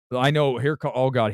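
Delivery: ordinary delivery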